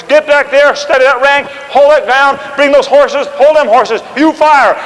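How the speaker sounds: loud, high rapid orders; shouted commands. Retold or imitated. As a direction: keep the ranks steady by shouting. Washington calling commands in battle.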